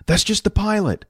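high-pitched put-on voice; sidekick's high-voiced dismissal; character voice for Jack. A narrator's reading. Jack explaining to Billy in character.

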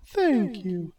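high, slow goddess greeting; Aphrodite, gracious. Acted. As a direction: greet him sweetly. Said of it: "Aphrodite said".

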